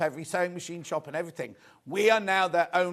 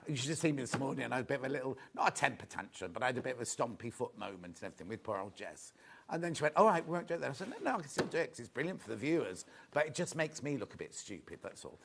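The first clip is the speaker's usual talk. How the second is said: dropped to a soft, confiding aside; hushed confiding aside; 4 semitones lower, 9 dB quieter, faster than usual. Real, not acted. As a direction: let them in on a little secret, softly. Confiding aside about morning tantrum.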